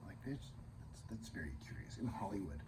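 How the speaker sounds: a hushed, secretive whisper; whispered aside. Real, not acted. Reviewer whispering a cheeky aside to viewers, hushed. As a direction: lean in and whisper it like gossip. Conspiratorial aside about Hollywood producers.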